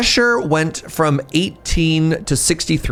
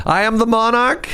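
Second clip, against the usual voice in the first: high, grand villain announcement; supervillain voice; 8 semitones higher than usual. Acted. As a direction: proclaim it like a cartoon villain. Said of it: "The Johnny Quest parody one?".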